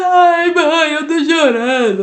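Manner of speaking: high, loud, drawn-out mock wail; hammed-up tearful child complaint; imitated crying child. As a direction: whine it out like a tearful little girl. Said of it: "e ela falou".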